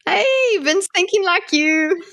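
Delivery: bright, high delighted call; excited. Real, not acted. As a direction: cheer at your own pun. Delighted pun on Vin's name.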